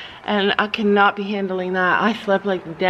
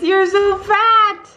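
cooing at toad; 10 semitones higher, 5 dB louder, much slower than usual. Real, not acted. Farm owner cooing at a fat toad, high and slow.

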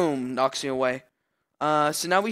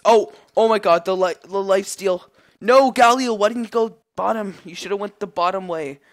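high, loud exasperated yell at a teammate; real gamer frustration; frustrated outburst; 5 semitones higher, 8 dB louder than usual. Real, not acted. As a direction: blame the teammate loudly. Teammate misplay in fight.

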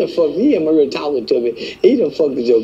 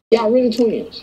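ordinary delivery

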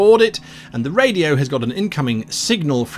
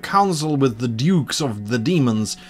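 put-on Russian-accented informant briefing newcomers; mock Russian accent, measured and grave; bad Russian accent. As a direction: warn them in a hammed-up Russian accent. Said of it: "doing my worst Russian accent possible".

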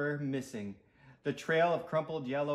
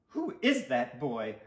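ordinary delivery

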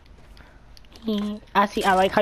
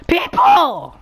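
alarmed shout; 11 semitones higher, 6 dB louder than usual. Real, not acted. Alarmed seeing enemies.

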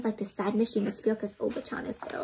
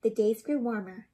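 plain reading voice

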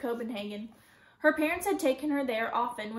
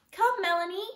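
lightly voiced higher doll voice; play-acting doll voice; 7 semitones higher, 4 dB louder than usual. A narrator's reading. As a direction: make the doll talk, pitched up. Girl voices paper doll in play.

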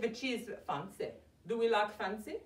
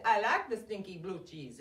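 normal talking voice

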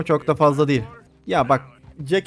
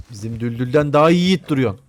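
ordinary delivery

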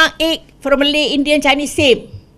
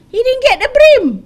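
"He didn't get the brim" much higher and louder; indignant outburst; 11 semitones higher, 6 dB louder than usual. Real, not acted. Politician outraged his qualifying driver was denied aid, loud. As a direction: burst out in disbelief on "brim".

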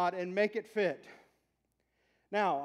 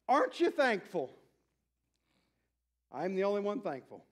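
normal talking voice

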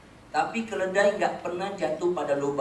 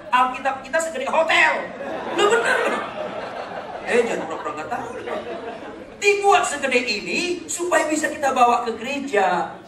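high, loud preaching rant through laughter; mock the colonel's logic, loudly and laughing; 9 semitones higher, 7 dB louder than usual. Pastor mocking a colonel's excuse, loud laughing rant.

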